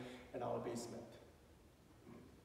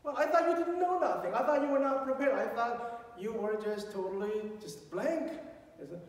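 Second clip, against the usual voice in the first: much higher, louder, astonished; amazed disbelief; 9 semitones higher, 6 dB louder than usual. Retold or imitated. Dad to son after the exam, in amazed disbelief. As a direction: sound floored by the good news.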